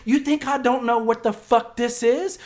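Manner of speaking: high, shouted outrage; angry retelling. Retold or imitated. Buddy berating him outside a dope house, angry. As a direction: play the incredulous buddy yelling full out.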